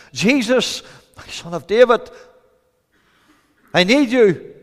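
high, loud plea; preacher acting an urgent cry for help. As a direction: call out in need. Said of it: "he cried to Jesus Christ".

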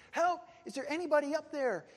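high, loud cry upward; calling for help. Acted. Fallen climber calling up from a branch, calling for help. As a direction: shout up as if stranded. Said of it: "he caught hold of a small branch and he said".

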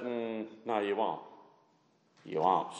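low, soft, understated reply; gently refute a boast, voice dropped low and soft; quiet contradiction. Acted. Acting Jesus' quiet reply to Peter.

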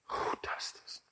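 breathy whisper of wonder. Acted. Preacher whispering to the congregation in awe. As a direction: let it fall to a bare whisper.

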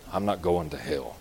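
low, soft, self-satisfied; mock smug boast. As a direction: murmur it like a smug churchgoer. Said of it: "walk around and say".

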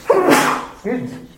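high, slow pardon after a sneeze. Real, not acted. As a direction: excuse yourself mid-sneeze. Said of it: "I'm about to sneeze again".

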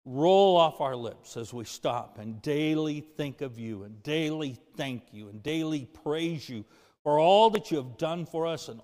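a pastor praying aloud to God; closing prayer. Acted. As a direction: pray it with reverence and rising fervour.

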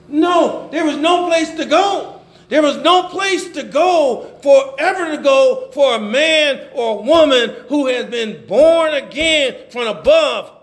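high, ringing pulpit declaration; fervent preaching. Acted. Preacher declaring the born-again have nowhere else to go, fervent. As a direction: proclaim it with conviction, stretching the key words.

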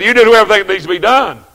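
preacher shouting the order a second time; booming repeated order. Retold or imitated. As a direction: hammer it out quickly. Boss's order repeated again.

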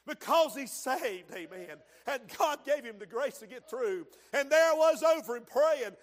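loud, punchy testimony from the pulpit; impassioned Southern-revival style sermon delivery; fervent preaching. Acted. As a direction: preach it with forceful bursts.

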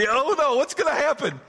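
high, loud hammed-up alarm; mock fright. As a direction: squeal it like a caught child. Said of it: "And they're like".

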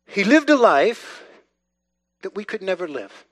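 normal talking voice

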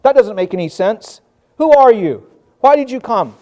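loud, incredulous challenge; scoffing outsiders. Retold or imitated. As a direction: fire back baffled and dismissive. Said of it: "Those who are not his sheep say".

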